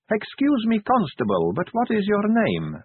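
high, timid and overly polite; meek. A narrator's reading. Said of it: "she said meekly".

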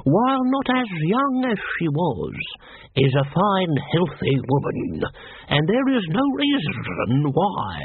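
high, slow lawyer's drone; tedious, drawn-out solicitor; droning lawyer. A narrator's reading. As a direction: drone it out as if from far away. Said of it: "the words coming from far away".

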